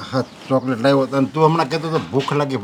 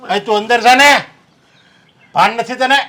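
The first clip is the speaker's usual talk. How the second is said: higher and louder, scolding; angry scolding; 6 semitones higher, 6 dB louder than usual. Acted. Older brother to younger brother, an angry scolding. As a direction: snap at him to get inside.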